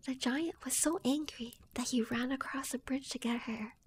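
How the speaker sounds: plain reading voice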